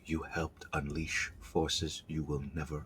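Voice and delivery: low, hushed ghost passing judgement; quiet spectral voice, deep and subdued; meek ghostly tone. Acted. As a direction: speak softly, like an accusing spirit. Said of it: "It speaks in a meek, ghostly tone".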